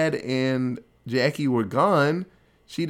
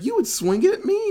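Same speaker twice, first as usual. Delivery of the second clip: much higher, fast mock outrage; the dad yelping in hurt disbelief, pitched way up; indignant dad; 3 dB louder, faster than usual. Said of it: "And the dad's like".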